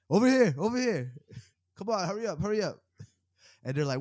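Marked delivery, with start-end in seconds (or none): 0.1-2.8 high, urgent call-out imitation; imitated beckoning call, pitched up; Jim Gordon calling over. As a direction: play a cop hurrying someone along. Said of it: "And Jim Gordon's like".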